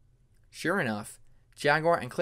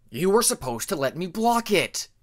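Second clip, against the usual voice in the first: higher, louder frustrated growl; angry growl; 5 semitones higher, 8 dB louder than usual. Acted. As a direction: snarl it at your sparring partner. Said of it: "Jaguar growled".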